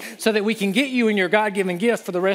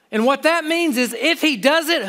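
ordinary talk, nothing marked

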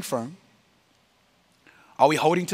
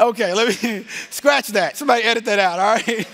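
high-pitched, amused backpedalling; laughing retraction; 8 semitones higher, 5 dB louder than usual. Real, not acted. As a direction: take it back through real laughter. Laughing, retracting his slip.

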